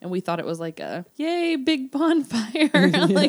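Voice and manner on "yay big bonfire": mock cheer. Mocking their own hopes.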